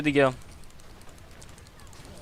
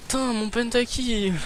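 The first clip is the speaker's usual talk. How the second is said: dismayed groan; 8 semitones higher, slower than usual. Real, not acted. Pentakill just missed.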